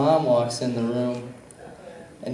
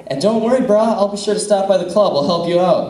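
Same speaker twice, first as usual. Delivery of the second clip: mock reassuring; 7 semitones higher, 5 dB louder, faster than usual. Teasing the kid about the club.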